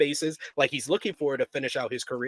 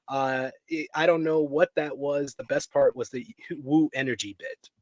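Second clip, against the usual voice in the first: ordinary talk, nothing marked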